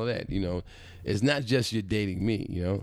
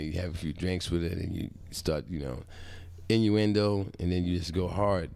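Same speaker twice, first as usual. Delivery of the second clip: normal talking voice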